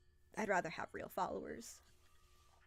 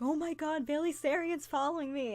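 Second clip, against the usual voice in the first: high, loud thrilled squeal of disbelief; replaying a fangirl scream, pitched up and loud; excited scream; 8 semitones higher, 6 dB louder than usual. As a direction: squeal like your idol just noticed you. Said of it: "So I legitimately screamed".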